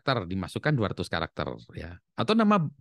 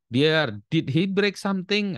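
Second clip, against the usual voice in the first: higher, slower, mock worried mum; worried mother; 9 semitones higher, 4 dB louder, slower than usual. Mother hears the school is calling, mock worried mother. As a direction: play the fretting mother, going up in pitch. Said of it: "Di si ibunya terima".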